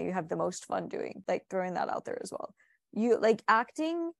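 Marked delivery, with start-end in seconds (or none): 3.5-4.1 word jumps up high out of nowhere; sudden high squeak on 'acting,'; odd sing-song voice. Real, not acted. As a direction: pitch 'acting,' way up, half-aware of it. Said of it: "I don't know why I'm talking like this".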